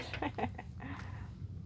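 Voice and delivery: quiet breathy chuckle; breathy laugh. Real, not acted. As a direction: laugh softly under breath. Laughs at a nip.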